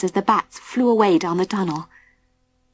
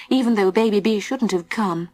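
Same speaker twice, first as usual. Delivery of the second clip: ordinary delivery, nothing marked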